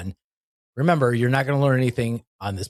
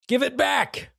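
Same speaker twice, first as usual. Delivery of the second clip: mock shout; 11 semitones higher, slower than usual. Shouted joke line.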